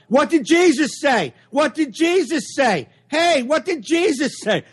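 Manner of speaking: high, hammed-up heckler yell; mock yell. Threatening to heckle the priest, high mock yell. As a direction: yell it out mid-sermon like a heckler. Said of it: "I'm just gonna yell out".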